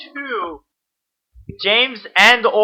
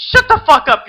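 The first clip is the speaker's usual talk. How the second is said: yelling; 9 semitones higher, 4 dB louder than usual. Real, not acted. Yelling at friend in banter.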